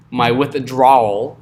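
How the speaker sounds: slow, stretched southern drawl; southern accent. Pretend. Teacher joking about saying withdrawal, southern accent. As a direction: draw it out in a playful southern accent. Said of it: "Sorry for my southern accent".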